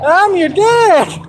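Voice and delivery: slow, very high mock cry; movie character imitation. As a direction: draw out the high put-on exclamation. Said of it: "in the one depiction?".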